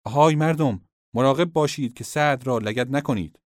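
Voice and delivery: a kinsman shouting to protect Sa'd; angry shout. Acted. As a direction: yell at the crowd in anger. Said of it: "با خشم فریاد کشید".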